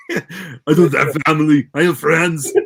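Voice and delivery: put-on loud, strained action-star shout with an accent; Arnold. Co-host parodies the family line as Arnold, loud impression. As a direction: belt it out as a hammed-up impression. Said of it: "the Arnold versions".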